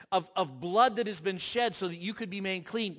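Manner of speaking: ordinary delivery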